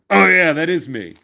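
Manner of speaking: a hint of a big guy owning it, amused; casual celebrity admission. Kevin Nash admitting the role.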